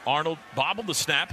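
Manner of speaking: ordinary talk, nothing marked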